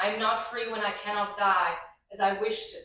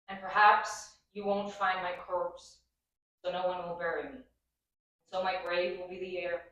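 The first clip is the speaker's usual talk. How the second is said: poem reading. Acted. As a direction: let each line land in grief. Reads a poem.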